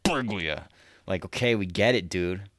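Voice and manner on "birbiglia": mock gut gurgle, squeezed out of the stomach; rumbling stomach voice. Imitating a nervous guest's gassy stomach rumbling, mock gut gurgle. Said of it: "His stomach's just going".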